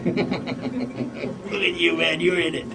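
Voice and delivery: soft, slow teasing through chuckles; cracking up. Retold or imitated. Amused dad teases his son, imitated cracking up. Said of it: "he's cracking up".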